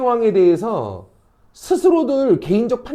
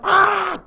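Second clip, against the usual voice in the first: short high-pitched cry of shock; imitated shriek of alarm; mock scream; 11 semitones higher than usual. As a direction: let out a startled bystander's yelp. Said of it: "소리 지르는 사람도 있고".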